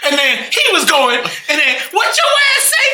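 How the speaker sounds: very high, squealed mock outrage; Steve Harvey. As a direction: squeal it like an incredulous TV host. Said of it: "when someone imitates Steve Harvey".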